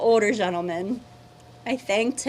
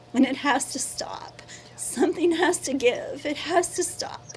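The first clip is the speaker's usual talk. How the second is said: breathy, breaking whisper through tears; barely getting the words out, crying; tearful whisper; 4 semitones higher than usual. Real, not acted. Resident recounting the derailment, whispering through tears.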